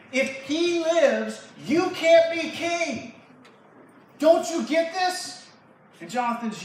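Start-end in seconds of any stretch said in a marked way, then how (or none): 0.1-5.5 high, slow, angry shout; furious king. Acted. Saul raging that his son can't be king, furious shouting. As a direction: bellow it word by word in rage. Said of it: "he gets so angry".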